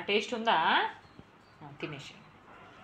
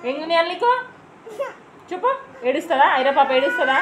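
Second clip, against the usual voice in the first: louder, higher coaxing voice; 10 semitones higher, 10 dB louder than usual. Real, not acted. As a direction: coax her out of tears, raising your voice over the crying. Mother soothing crying daughter.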